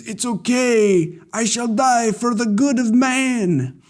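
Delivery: high, slow, grandly noble put-on; slow hammed-up heroic farewell; mock noble voice. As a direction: play it as a hammy martyr. Voicing a noble sacrifice.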